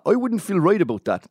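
terrible Tommy. Retold or imitated. Doing a terrible impression of Tommy, mock Luton accent. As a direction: ham up Tommy's Luton voice badly. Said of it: "I'm doing a terrible Tommy".

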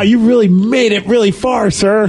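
mock praise. Taunting the weaver stuck at the red light, sarcastic. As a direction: congratulate him with heavy irony.

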